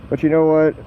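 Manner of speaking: ordinary delivery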